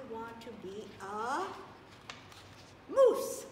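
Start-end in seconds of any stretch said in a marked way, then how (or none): 2.9-3.5 big loud high exclamation; excited. Acted. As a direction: burst out with the word like a surprise. Big reveal in kids' story.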